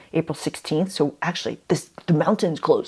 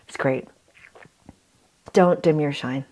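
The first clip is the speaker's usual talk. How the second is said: usual speaking voice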